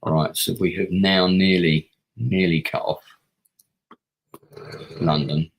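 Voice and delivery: nearly said twice with heavy weight; stress on nearly. Shown as a demonstration. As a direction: lean hard on the word nearly. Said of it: "Emphasis on the word nearly".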